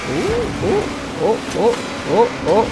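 high, quick string of oohs and ohs; alarmed yelps. Real, not acted. Player narrowly avoiding a crash, alarmed yelps. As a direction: yelp in short high bursts. Nearly crashes while racing.

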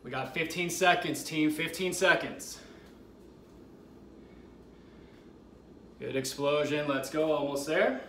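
loud rising push to the finish; excited countdown hype. Real, not acted. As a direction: drive them through the last seconds. Final seconds hype.

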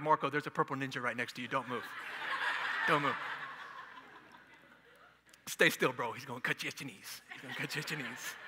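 sarcastic, falling to near silence; mock hallucinating friend. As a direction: play a spooked friend warning Marco under your breath. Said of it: "Y'all like".

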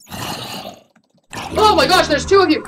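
high, loud startled outburst; startled alarm. Real, not acted. Gamer finding two zombies at once, startled cry. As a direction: cry out on being ambushed.

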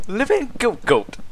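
high, put-on Welsh accent. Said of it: "how do you do with a Welsh accent?".

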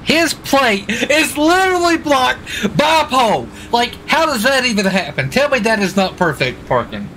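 can't believe the luck, shouting it out; amazed outburst. Real, not acted. Amazed at the car's blocked plate.

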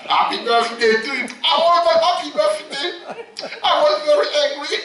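high and fast, laughing; hammed-up laughing complaint; laughing mock voice. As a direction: rattle it off giggling in character. Laughing put-on voice.

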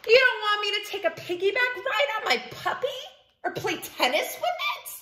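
high, quick indignant complaint; indignant pigeon. Acted. Pigeon accusing readers of denying it a puppy, indignant. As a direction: rattle it off high and put out. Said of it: "This is called sulking".

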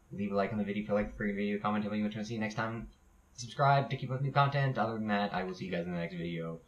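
rattled off very fast like an auctioneer; fast talking. Shown as a demonstration. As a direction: rush the outro at top speed. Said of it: "say it so fast and sound like an auctioneer".

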